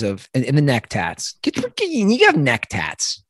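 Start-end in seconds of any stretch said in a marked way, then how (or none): 1.4-3.2 put-on high, drawn-out old-man fussing; old man griping. Host griping about neck tats like a fussy old man. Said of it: "old man handing away of the neck tats".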